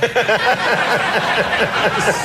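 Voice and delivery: high giggle; giggling laugh. Real, not acted. Host giggling before the Super Dave story, high giggle. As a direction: giggle before you start.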